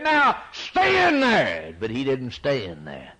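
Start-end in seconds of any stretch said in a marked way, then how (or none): 0.0-1.7 high, loud, slow command; mocking shout. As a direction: shout it down at the sealed tomb. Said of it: "and said".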